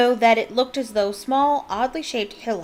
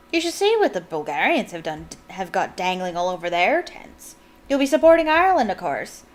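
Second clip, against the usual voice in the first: light Irish mother, pushy toward the end; an Irish mum pressing the guests; terrible Irish accent. Retold or imitated. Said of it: "my terrible Irish accent".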